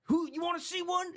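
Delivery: high, taunting mock driver voice; angry driver. Imitating the angry Uber driver.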